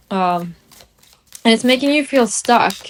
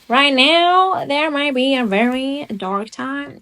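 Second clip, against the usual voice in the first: failed Southern accent; 5 semitones higher, slower than usual. Shown as a demonstration. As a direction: attempt at a Southern US drawl, higher and slow. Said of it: "That was not a southern accent at all".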